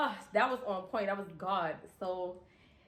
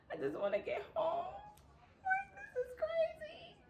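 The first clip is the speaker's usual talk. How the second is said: high, crying laugh, voice breaking; near tears; 6 dB quieter than usual. Real, not acted. Overwhelmed after the crash, half laughing half crying. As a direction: let it crack into a tearful laugh. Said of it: "I'm so lit right now".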